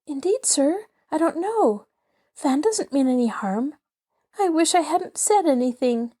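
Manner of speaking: put-on high, nervous stammering girl. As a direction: falter out the excuse, frightened. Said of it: "stammered Polly".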